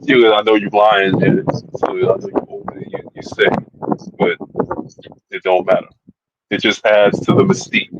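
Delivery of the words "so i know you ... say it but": whispering. Real, not acted. Teasing Janet.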